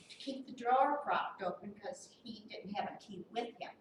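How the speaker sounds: ordinary delivery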